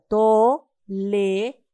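tolerancia in four separated syllables, to-le-ran-cia; teacher spelling out tolerancia by syllables. Shown as a demonstration. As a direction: slowly break tolerancia apart, pausing between syllables. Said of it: "si lo separamos en sílabas".

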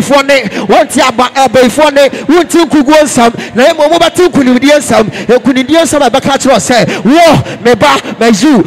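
loud, driving Pentecostal sermon; fervent preacher rousing the congregation; full-force preaching. Real, not acted. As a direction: preach it hard in short, punchy phrases.

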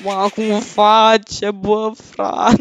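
loud, very slow stretched wail, cut short; panicked cry. Real, not acted. Player nearly caught by Grimace, drawn-out panicked cry.

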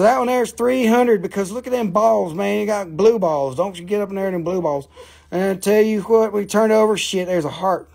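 put-on Southern-accented sales banter. Seller pricing the rock and turning it over, Southern accent. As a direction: play up the Southern twang. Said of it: "Georgia Southern accent".

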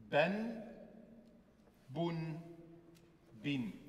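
"Ben," "Bun," "Bin." each ending in a clear added n; a teacher showing the double vowels, crisp n endings; nunation demo. Shown as a demonstration. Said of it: "einfach ein N hinzufügen".

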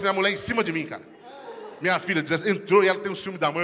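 ordinary talk

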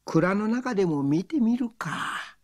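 lightly voiced rustic old man; a hint of a drawling village man.

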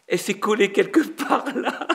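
laughing through the words, pitch climbing. Real, not acted. Storyteller amused by his lost keepsake, laughing. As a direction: tell it through a high laugh.

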